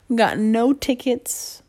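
ordinary talk, nothing marked

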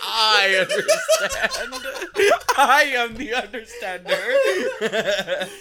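high, slow, laughing proclamation; mock proud actor. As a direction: declaim it grandly while cracking up. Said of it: "And you're like".

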